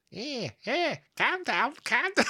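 high Scouse "calm down, calm down"; Scouse accent. Retold or imitated. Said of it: "I used to do a good Scouse accent".

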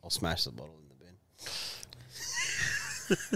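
ordinary talk

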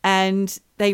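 ordinary talk, nothing marked

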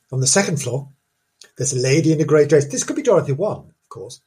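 usual speaking voice

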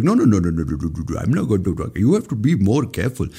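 mocking the government telling people no at the last minute; mock official scolding. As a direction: wag a finger at the public like an official. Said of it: "they tell you, like".

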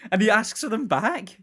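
normal talking voice

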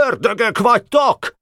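high-pitched outcry; shouted accusation. A narrator's reading. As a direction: cry out high at the enemy under fire. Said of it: "kiáltott". (magas hangú, vádló kiáltás)

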